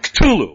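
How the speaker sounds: Cthulhu spat loudly with a hard "t". Shown as a demonstration. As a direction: spit out Cthulhu as "k'TOO-loo", tongue at the top of the mouth. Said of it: "you're spitting the name out".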